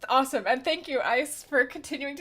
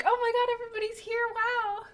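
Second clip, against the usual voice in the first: excited gush; 11 semitones higher than usual. Retold or imitated. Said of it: "and now I'm like".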